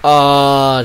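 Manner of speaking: sudden loud startled ah; startled cry. Real, not acted. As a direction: jolt out a loud ah. Sudden alarm at witch.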